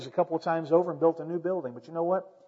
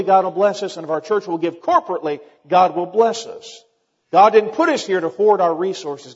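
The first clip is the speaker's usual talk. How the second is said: rising preaching; 2 semitones higher, 6 dB louder than usual. Acted. Pastor urging his church to give, in fervent preaching. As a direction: preach it to the congregation with rising force.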